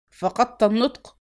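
mild impatient exclamation; called out. A narrator's reading. Gang chief to the stunned baker, impatient call. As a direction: call out at his silence. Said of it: "فهتف".

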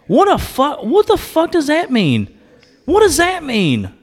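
high-pitched incredulous outburst; exasperated disbelief. Real, not acted. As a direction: demand an answer in baffled outrage. Exasperated at the played remark.

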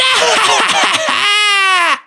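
hohes, schallendes Schurkenlachen; donnerndes Gelächter. Acted. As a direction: triumphierend loslachen. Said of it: "Er lachte. Schallendes, donnerndes Gelächter". (schallendes Bösewicht-Lachen)

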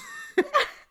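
squealing high laugh. Real, not acted. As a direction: burst into a shrill laugh. Burst of laughter.

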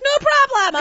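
very high, loud put-on reply; hammed-up yell as the counter guy; mock shout. Counter guy answering the irate traveller, mock shout.

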